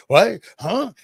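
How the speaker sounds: retold dad's startled high what, loud and sharp. Dad hearing grandma is here, startled high what. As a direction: jump in pitch like the news just hit. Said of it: "And he's like".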